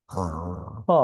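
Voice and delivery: হ low and soft from deep in the throat; throaty ha. Shown as a demonstration. Teacher showing the throat sound হ. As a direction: breathe হ from the back of the throat. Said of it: "এই আওয়াজটা আমি এই কারণেই করছি".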